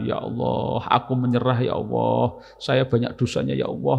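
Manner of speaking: softly murmured confession to God; hushed, contemplative plea to Allah; reflective prayer. Acted.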